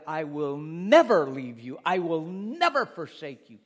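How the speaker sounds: loud, slow, big on never; thundering divine promise; booming voice of God. Acted. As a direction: proclaim it like God speaking.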